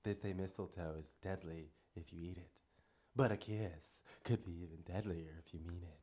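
low, soft, seductive purr; sultry Catwoman voice. Retold or imitated. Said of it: "the deep, sultry Catwoman voice".

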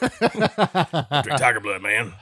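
slow, drawn-out mock Charlie Sheen with a laugh; Charlie Sheen impression. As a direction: ham up the Tiger Blood line. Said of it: "Charlie Sheen?".

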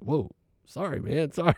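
high, taken-aback "whoa, sorry" with a laugh; startled apology. Retold or imitated. Patient startled by the therapist's scolding, sheepish apology. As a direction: back off quickly, sheepish and surprised.

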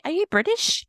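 very high-pitched mimicked question; mock curiosity, high and rising; curious questioner. Imitates someone asking about her accent.

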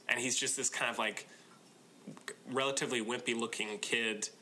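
ordinary talk

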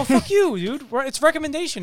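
high, loud comeback; bantering outburst. Real, not acted. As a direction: fire back loudly at the interruption. Bantering after being interrupted.

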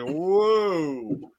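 long falling whoa in awe; a crowd in stunned awe; a drawn-out wow from the stands; imitated crowd whoa. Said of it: "they're all just like".

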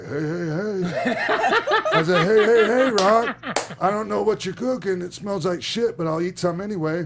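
hamming it up on air; playful shout. Retold or imitated. Retelling his skit line.

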